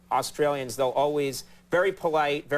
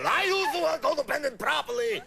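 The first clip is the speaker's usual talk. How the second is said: high, put-on angry motorcyclist voice; hammed-up indignant self-defence, pitched high; mock angry man; 9 semitones higher than usual. Chasing her on a motorcycle, mock angry man.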